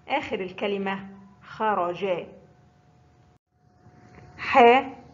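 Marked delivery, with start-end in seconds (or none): 4.4-5.0 loud, clear announcement of حاء; loud teacher naming the letter حاء; letter announced. Shown as a demonstration. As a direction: call out حاء to the class. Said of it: "حرف الحاء محرك بحركة الفتح".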